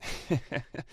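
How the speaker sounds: high gloating cackle; cackle of laughter. Acted. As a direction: cackle at his expense. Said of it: "He lets out a cackle of laughter".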